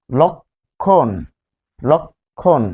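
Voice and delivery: "লক্ষণ" drawn out as lok... khon; rounded lo, split. Shown as a demonstration. Teacher models the word twice, "লক্ষণ" split as lok... khon. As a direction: break "লক্ষণ" in two slow halves, lok and khon. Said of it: "লো".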